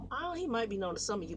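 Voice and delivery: usual speaking voice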